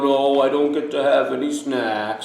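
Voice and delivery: mock mopey complaint; put-on woe-is-me moping. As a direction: moan it like a self-pitying sulker. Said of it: "Don't go moping around".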